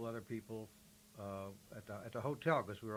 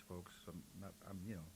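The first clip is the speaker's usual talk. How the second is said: talking while chewing candy; words round a butterscotch; mouth full; 4 semitones lower, 9 dB quieter than usual. Real, not acted. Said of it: "I'm chewing on butterscotch".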